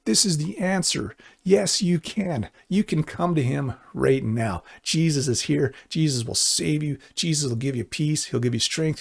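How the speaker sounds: usual speaking voice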